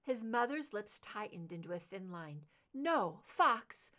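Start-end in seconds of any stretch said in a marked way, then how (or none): 2.7-3.9 sharp pleading no; emphatic refusal. Acted. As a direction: cut him off firmly.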